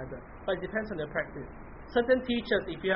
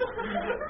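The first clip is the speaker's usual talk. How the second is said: breathy laugh; 4 dB quieter than usual. Real, not acted. Laugh between words.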